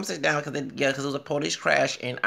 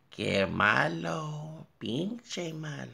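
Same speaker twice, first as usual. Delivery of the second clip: slow, soft, higher-pitched lament; hushed disapproval; 3 semitones higher, 6 dB quieter, much slower than usual. Pretend. Reacting in Spanish to the cursing anchor, slow and soft. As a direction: draw it out softly.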